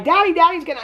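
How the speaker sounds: put-on little girl voice. Young daughter announcing her dad's release, high childish chirp. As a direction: squeak it out like an excited little kid. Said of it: "Her daughter's like".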